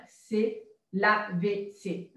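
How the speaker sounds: A V C spelled slowly, letter by letter. Shown as a demonstration. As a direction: spell out A, V, C clearly. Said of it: "l'acronyme A V C". (épeler « A V C » lettre par lettre, lentement)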